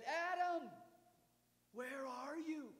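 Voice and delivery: high, loud call across the garden; God calling Adam. Acted. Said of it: "God said".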